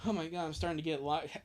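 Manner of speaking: normal talking voice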